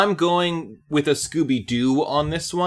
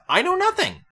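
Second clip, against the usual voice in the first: silly voice. Pretend. Joking feigned ignorance.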